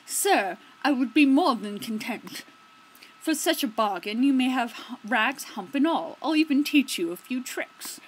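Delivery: hearty excited beggar voice. Acted. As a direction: gladly take the deal, loud.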